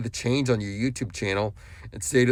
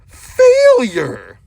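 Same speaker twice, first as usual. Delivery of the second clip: one drawn-out yell, much louder and higher; sudden shout; 13 semitones higher, 14 dB louder than usual. Real, not acted. Vlogger warning the man he berates, sudden loud yell. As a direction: burst into a long, high shout on the word. Insult yelled to end the warning.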